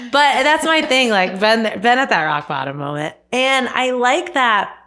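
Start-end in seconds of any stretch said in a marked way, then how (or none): none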